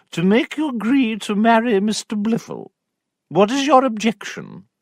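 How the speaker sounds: high, stern aunt voice; a pressing aunt, pitched high; prim aunt. Acted. Aunt pressing Sophia to marry, high stern voice.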